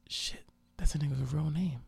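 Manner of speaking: hushed, voiceless aside; whispered realization. Retold or imitated. Realizing it to oneself, whispered. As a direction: whisper it as it dawns on you.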